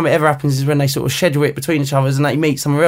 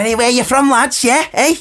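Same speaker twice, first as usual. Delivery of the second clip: higher mock northern accent, teasing; northern lad accent; 10 semitones higher than usual. Explaining to the host how northern fans talk, in a mock northern accent. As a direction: chirp it like a northern fan picking a fight. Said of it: "that's what they normally say".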